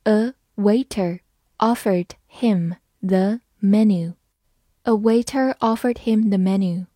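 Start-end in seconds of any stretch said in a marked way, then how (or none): 0.0-4.1 slow, word by word; slow read-through. Shown as a demonstration. Teacher rereads the menu sentence for learners, slow read-through. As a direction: read it slowly with a gap after each word. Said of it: "好，我们慢读一遍".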